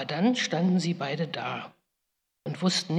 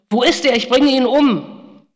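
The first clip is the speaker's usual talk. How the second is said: furious bellow; 8 semitones higher, 13 dB louder than usual. Retold or imitated. Esau roaring for his fled brother, furious bellow. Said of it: "Als Esau brüllte".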